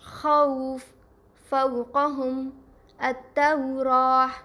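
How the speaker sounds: slow, drawn-out au glide in خوف and فوقہم; Arabic pronunciation. Shown as a demonstration. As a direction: say خوف as khauf, gliding a into u. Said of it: "واولین کی مثالیں".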